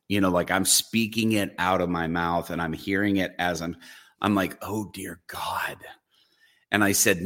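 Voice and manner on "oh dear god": breathless whispered oh dear God; whispered dread. Retold or imitated. Pastor whispering to himself, aghast, in a horrified whisper.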